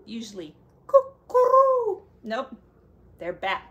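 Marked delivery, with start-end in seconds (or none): 0.9-2.0 high, loud cuckoo call. Acted. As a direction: sing out a cuckoo. Cuckoo sound imitated.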